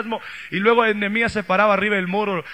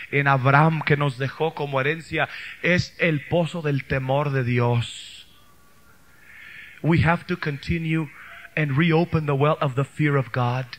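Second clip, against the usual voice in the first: ordinary talk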